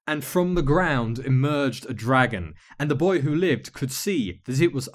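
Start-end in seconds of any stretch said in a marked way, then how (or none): none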